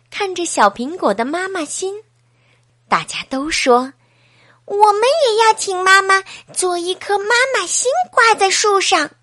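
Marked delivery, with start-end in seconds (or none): 4.7-9.1 lightly voiced eager kids, higher and louder; children chorusing. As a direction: chime in like a class of eager children. 孩子们齐声要求. (一群孩子兴奋地齐声说)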